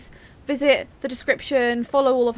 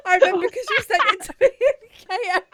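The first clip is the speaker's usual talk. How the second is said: laughing; 10 semitones higher, 4 dB louder, faster than usual. Real, not acted. Laughing about a hate comment.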